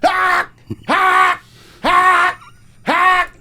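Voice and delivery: high loud repeated cries of Ah; imitated screams. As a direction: mimic a man yelling like an alarm. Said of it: "laying on the sidewalk going".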